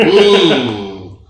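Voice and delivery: ordinary talk, nothing marked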